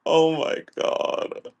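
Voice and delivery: breathy slow oh my God; a slow disgusted whisper. Real, not acted. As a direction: whisper it, repulsed. Disgusted aside.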